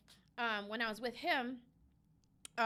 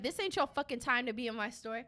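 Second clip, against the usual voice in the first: ordinary talk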